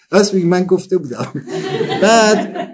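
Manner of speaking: laughing through the words; giggling at his own story. Real, not acted. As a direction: crack up while admitting it.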